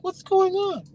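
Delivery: very high imitation of a gawker; mock puzzled voice. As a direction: squeak it out, mimicking a clueless onlooker. Mimics drivers looking around.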